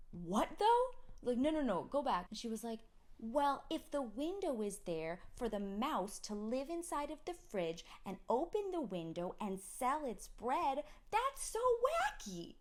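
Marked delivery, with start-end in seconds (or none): 0.1-2.3 much louder, higher baffled cry; mock confusion. Mother retelling her reply, loud disbelief. 3.2-12.6 put-on toddler explaining logic; imitating a child. Mother imitating daughter, high kid voice.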